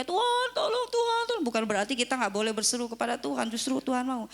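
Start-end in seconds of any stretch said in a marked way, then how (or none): 0.0-1.4 high pleading mock cry. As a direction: imitate a panicked believer begging. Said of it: "kita hanya teriak-teriak". (meniru seruan minta tolong yang panik)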